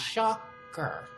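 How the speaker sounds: high, drawn-out sarcastic shocker; mock shocked. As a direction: echo it in a mocking high voice. Echoes the word mockingly.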